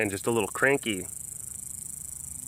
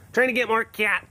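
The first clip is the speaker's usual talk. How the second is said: "Trying to get more cats" echoed higher and playful; light sing-song repeat of "Trying to get more cats"; 9 semitones higher, 4 dB louder than usual. Shown as a demonstration. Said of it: "repeats it".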